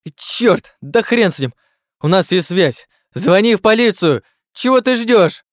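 high, loud frantic demands; panicked shouting. A narrator's reading. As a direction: shout in rising desperation. Panicked Jerome demands a phone call.

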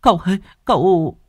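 high, loud tearful wail; crying servant girl. A narrator's reading. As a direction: wail over the fallen master. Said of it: "Tư Hiền khóc lóc".

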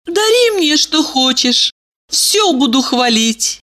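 put-on high sugary girly voice; bubbly sorority girl. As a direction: gush it sweetly and girlishly. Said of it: "сказала она".

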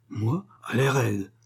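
slow, held-in exasperation; ready to burst. A narrator's reading. Husband told to make no scene, ready to burst. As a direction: drag it out, temper barely contained. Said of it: "dit Lahuche près d'éclater".

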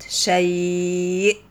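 "شيء" with the "ay" glide held long before the stop; drawn-out madd leen. Shown as a demonstration. Teacher gives an example of madd leen, "شيء" very drawn out. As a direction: stretch the middle of "شيء" slowly, then stop on it. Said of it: "مد لين تمد حركتين أو أربعة أو ستة".

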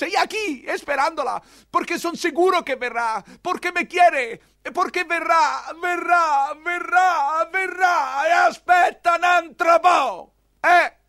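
high, loud, rising to a shout; an obsessed old lover shouting his hope; frantic insistence. Acted. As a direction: cry out that she will come. Old man insists she'll come.

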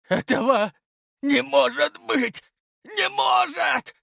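high, strained anguished scream; screaming, voice breaking. A narrator's reading. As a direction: scream it out in despair, voice cracking. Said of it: "и закричал во всё горло, срывая голос". (истошный крик, срывающийся голос)

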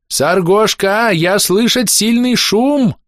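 high, drawn-out yell; shouting over noise. Acted. Goblin Тыждак tries to outshout Нагльфаар's wail, yelling. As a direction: strain to be heard over a deafening wail. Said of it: "заорал Тыждак, пытаясь перекричать вопль".

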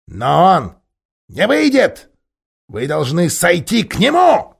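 high, loud sneering ape; scornful ape. Acted. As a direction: sneer at the bear. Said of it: "передразнил Хитр".

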